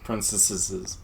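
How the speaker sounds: Princesses said as prin-ses-ses-es; extra syllables. Pretend. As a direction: stretch Princesses with a doubled -es ending. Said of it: "Is that what the plural is?".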